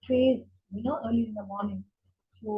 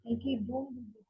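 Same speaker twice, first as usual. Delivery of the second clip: the same plea repeated much softer, a hush on Kaikeyi; softer second plea; 9 dB quieter than usual. Retold or imitated. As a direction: drop the voice on the second Kaikeyi, don't do this. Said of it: "he starts begging".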